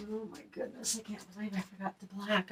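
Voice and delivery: half-whispered oops, talking to no one; muttered aside. Real, not acted. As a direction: mumble it under your breath, annoyed at yourself. Muttering to herself mid-craft.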